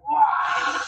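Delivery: high, breathy "wah" of a demon; imitated demon noise. Telling how the demon in her went, imitated demon noise. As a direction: mimic a sudden high, airy demonic noise. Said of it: "this was just going".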